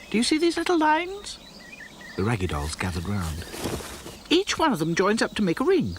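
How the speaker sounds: high, eager doll voice; bright little know-it-all; Dotty explaining. A narrator's reading. Dotty showing the dolls the rings, high and eager.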